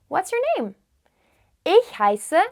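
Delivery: ordinary delivery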